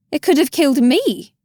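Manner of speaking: COULD stressed, high and loud; indignant outburst. A narrator's reading. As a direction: hit the word could, outraged. Indignant retort in narration.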